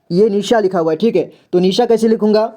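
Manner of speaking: normal talking voice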